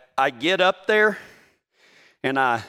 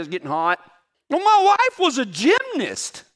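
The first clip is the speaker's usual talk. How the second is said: normal talking voice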